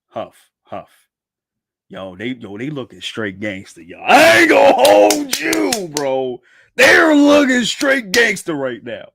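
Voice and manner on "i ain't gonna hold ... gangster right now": hyped. Real, not acted. Hyped by the manga panels.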